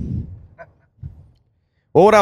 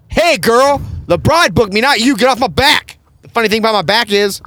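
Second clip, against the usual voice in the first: put-on high, loud sassy "girl" voice, much higher; sassy imagined comeback; 11 semitones higher, 5 dB louder than usual. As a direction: snap back at her in a shrill-free, high put-on sass. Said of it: "And I was like".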